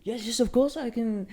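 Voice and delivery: a keen French engineer agreeing; French accent. Retold or imitated. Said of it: "Yeah, French".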